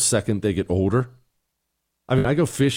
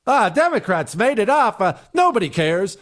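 higher, scoffing brush-off; dismissive mock quote; 8 semitones higher than usual. Mocking the Democrats-made-it-up excuse, higher scoff. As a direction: scoff it away, pitched up. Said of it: "is a ridiculous answer".

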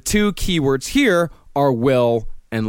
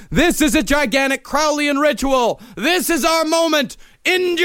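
high-pitched mock-grand announcement; hammed-up rallying call; grand proclamation; 10 semitones higher than usual. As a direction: proclaim it to a vast crowd. Proclaims to all the Crowleyites.

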